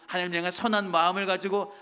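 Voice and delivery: ordinary talk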